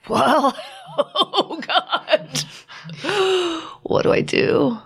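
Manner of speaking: high, slow laugh through the words; disbelieving laugh. Real, not acted. Asking her husband after his painful answer, laughing in disbelief.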